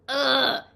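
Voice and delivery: loud choked cough sound; choking ugh. Real, not acted. Host choking before the intro, loud ugh. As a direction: clear the throat hard after choking. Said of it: "Let me choke first".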